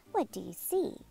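ordinary delivery

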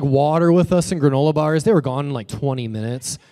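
usual speaking voice

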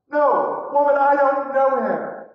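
loud, high-pitched protest; agitated, insistent outburst; panicked denial. Retold or imitated. Peter falls apart, denies Jesus.

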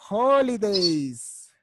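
Holidays. drawn out very slowly for the class to copy; a teacher modelling Holidays. slowly for children to repeat; slow model word. Shown as a demonstration. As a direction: stretch Holidays. out slowly, syllable by syllable. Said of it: "repite después de mí".

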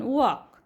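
वॉक with no L, just वॉ-क; silent L. Shown as a demonstration. Teacher gives the right way, वॉक with a silent L. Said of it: "लचा उच्चार करत नाही आपण".

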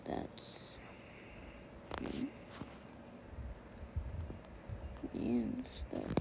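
hushed late-night whisper. Real, not acted. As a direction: whisper so nobody wakes up. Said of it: "why I sound like this".

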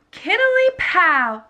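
plain reading voice

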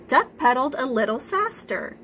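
ordinary delivery, nothing marked

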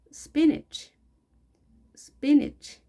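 "spinach" ending in a short "-ich"; high-pitched model word, "spinach" as "SPIN-ich". Shown as a demonstration. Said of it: "A 발음이 I로 발음이 됩니다". ("spinach"를 '스피니치'처럼 짧게)

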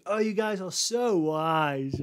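very slow sarcastic praise; mocking. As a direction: tease them with fake admiration. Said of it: "So saying".

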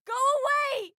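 high, upset cry; high-pitched outburst; upset command. Acted. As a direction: drive them off with a high yell. Acted order to leave.

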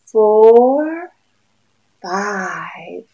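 ordinary delivery, nothing marked

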